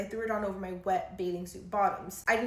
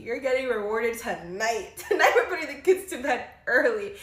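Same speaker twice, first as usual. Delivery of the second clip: louder, giggling through a teasing promise; flirty laughing; 5 dB louder than usual. Real, not acted. Wife laughing about rewarding her husband. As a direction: tease him with a laugh.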